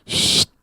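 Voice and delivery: sharp "shh"; shushing. Acted. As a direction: hush someone noisy. Crowd shushes the shouting man. (desis menyuruh diam)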